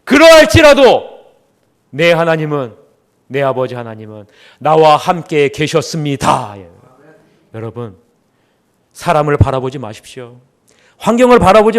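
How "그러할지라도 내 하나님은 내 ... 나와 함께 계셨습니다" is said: Jacob's last words given slowly and solemnly; slow confession of faith. Retold or imitated. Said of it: "야곱의 마지막 고백은요".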